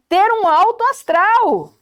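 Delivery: agudo, forte e pausado; alto astral. Shown as a demonstration. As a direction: anime a turma com energia. Demonstra palavra positiva com ênfase. (empolgação alegre e enfática)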